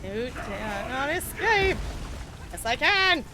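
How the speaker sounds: mock defiant shouting. Mock dialogue with game enemies.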